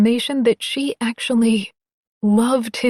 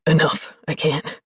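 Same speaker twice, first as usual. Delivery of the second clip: deep male voice; 4 semitones lower than usual. Acted. Sarge low, overwhelmed.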